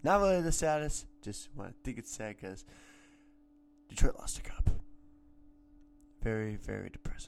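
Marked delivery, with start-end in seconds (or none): 3.9-7.3 mock-gloomy whisper; hushed, mock-tragic whisper; whispered sadness. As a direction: whisper it like mourning. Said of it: "now depression sets in".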